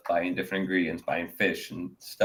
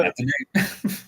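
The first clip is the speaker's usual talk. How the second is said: high, slow whispery put-on voice; mock whispered sarcasm; 12 semitones higher, much slower than usual. Friend acting out a stunned reaction, whispered and slow. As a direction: breathe it out in disbelief.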